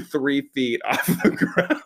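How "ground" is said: ordinary talk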